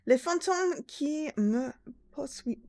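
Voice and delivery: halting attempt at French; atrocious French accent. Shown as a demonstration. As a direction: read French slowly, stumbling over the verb. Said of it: "Sorry, my atrocious French accent".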